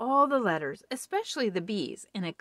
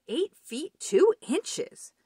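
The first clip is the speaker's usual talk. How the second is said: high, slow, louder announcement; amazed nurse; 9 semitones higher, 6 dB louder, much slower than usual. Acted. Nurse measuring Big Bird, slow amazed high readout. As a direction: draw it out in disbelief.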